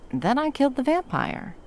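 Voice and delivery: high, loud, sudden blurt. Acted. Cutting the gory story short for the partner, a high blurt. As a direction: rush it out high to get it over with.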